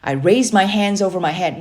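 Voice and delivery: ordinary delivery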